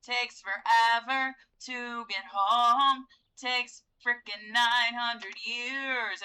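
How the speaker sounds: exasperated whine. Real, not acted. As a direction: stretch it out, fed up. Waiting for Sim to get home.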